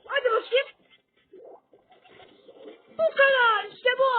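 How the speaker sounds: loud, high triumphant shouting; excited cheer. Real, not acted. Gamer cheering his own win, loud triumphant shout. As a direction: yell it out as the win lands.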